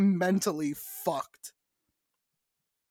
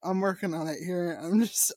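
normal talking voice

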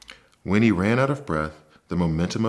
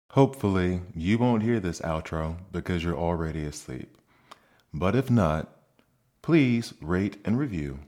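gentle outro. Real, not acted. Podcast outro.

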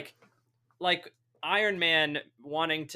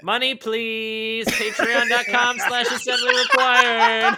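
higher and louder, slow and stretched then laughing, British-free ad voice; hammy plug; 10 semitones higher, 11 dB louder, slower than usual. Pretend. Podcaster begging listeners for Patreon money, hammy loud and high. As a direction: beg for money like a cheesy announcer.

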